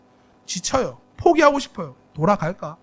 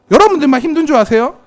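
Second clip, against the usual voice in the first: usual speaking voice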